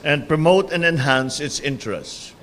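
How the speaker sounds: solemn, measured oath-taking; court oath. Acted. Administers the oath of office.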